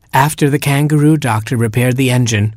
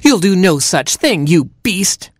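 indignant cry; 8 semitones higher than usual. Acted. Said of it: "she cried".

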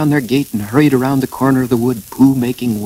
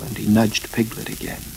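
ordinary delivery

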